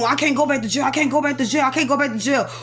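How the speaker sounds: rapid, looping mutter of worry; imitated panicked repeating. Attacker pacing the room afraid of jail, imitated frantic repeating. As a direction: run the repeats together, imitating his panic. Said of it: "he's pacing back and forth".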